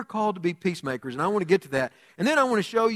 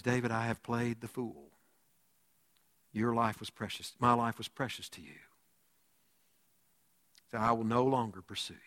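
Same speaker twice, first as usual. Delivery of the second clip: low, soft, humbled voice; subdued confession; 7 semitones lower, 9 dB quieter than usual. Acted. Saul admitting his wrong to David, low and subdued. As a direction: confess quietly, well under your usual voice.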